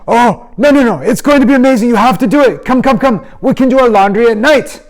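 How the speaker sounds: high, loud, pleading friend voice; eager kid nagging his buddy; pushy friend. Retold or imitated. Friend begging him to play.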